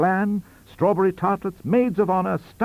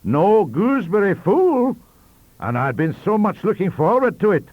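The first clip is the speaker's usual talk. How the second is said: disappointed Sir John; 12 semitones higher, 9 dB louder than usual. Acted. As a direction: mourn the missing pudding like a spoiled old lord. Sir John laments missing dish.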